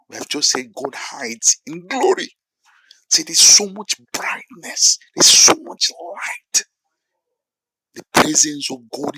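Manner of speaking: high, loud preaching at full force; impassioned preaching. Real, not acted. Preacher telling congregation of God's blinding glory, impassioned high shout.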